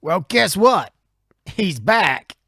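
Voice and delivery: high, hammed-up retort; alarmed henchman. Henchman warning boss of the pursuer, acted alarm. Acting out villains in the truck.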